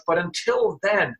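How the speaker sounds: usual speaking voice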